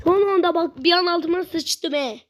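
ordinary delivery

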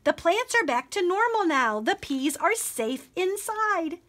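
high, understated joyful announcement; excited cry. A narrator's reading. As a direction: announce the good news, drawing out the end. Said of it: "Pippa cried".

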